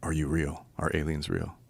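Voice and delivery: low, soft question to an alien; tentative, low-voiced retold question; hushed retold question. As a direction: ask it quietly, pitched well down. Quoting his question to the alien.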